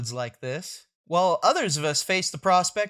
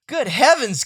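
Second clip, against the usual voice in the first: plain reading voice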